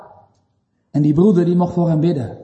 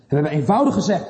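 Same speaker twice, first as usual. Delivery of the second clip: usual speaking voice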